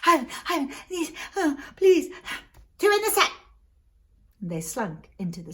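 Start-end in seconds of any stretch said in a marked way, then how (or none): 0.0-2.1 high, panting, desperate begging; gasping plea. Acted. Beaten brother at Ivan's house begging rescue, gasping. 2.8-3.3 high, loud, quick cry; urgent command. Acted. Desperate command sending cudgels back, urgent.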